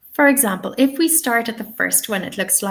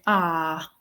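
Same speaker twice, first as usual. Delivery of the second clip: ah as the RP vowel of "car", no R; long R-less RP ah; received pronunciation accent. Shown as a demonstration. Teacher gives the RP speaker's sound, RP accent. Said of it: "So they would say".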